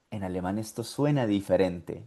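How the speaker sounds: ordinary delivery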